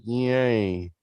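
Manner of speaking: mock cheer, stretched out; half-hearted cheer. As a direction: imitate a feeble little celebration. Retold own half-hearted cheer.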